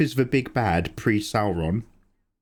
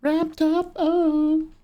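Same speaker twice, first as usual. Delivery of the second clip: squeaky voice; much slower than usual. Pretend. Squeaky aside.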